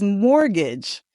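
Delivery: "mortgage" said slowly as MOR-gij; careful model "mortgage", first syllable stressed; silent T. Shown as a demonstration. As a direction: say "mortgage" with no T at all. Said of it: "be very careful because the T is silent".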